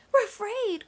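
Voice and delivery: high, loud frightened cry; lightly acted scared fish. A narrator's reading. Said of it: "cried the fish".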